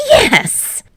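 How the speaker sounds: high squeal of victory; triumphant. Acted. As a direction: cheer it out. Winning at cards.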